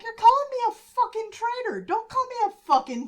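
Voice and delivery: Bobby retold, outraged at Scott; indignant outburst. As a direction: bristle at the insult, voice jumping up. Bobby furious at being called traitor.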